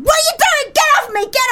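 high, loud mock yell; panicked waking shout. Retelling waking up in a shared bedroom, high panicky shout. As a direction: shriek it like fending someone off. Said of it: "I used to wake up at two o'clock in the morning going".

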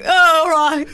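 loud laughing "oh, all right"; laughing mock shout. Mocking someone who fell over, loud laughing cover-up. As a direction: shrug off the fall out loud. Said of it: "you go".

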